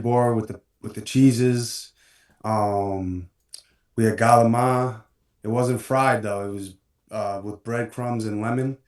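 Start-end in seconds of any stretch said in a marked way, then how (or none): 4.0-5.0 galama, clipped with a hard g, no final i; Italian family style galama; Italian-American galama. Real, not acted. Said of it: "say that word again".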